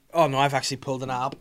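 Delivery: normal talking voice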